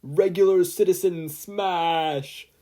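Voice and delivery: a passerby punching the ball away, with a heroic shout; calling out. A narrator's reading. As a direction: bellow it like a hero's battle cry. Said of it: "a voice familiar to Izuku called out".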